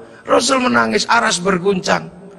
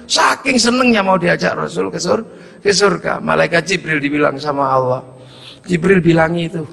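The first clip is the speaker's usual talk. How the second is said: usual speaking voice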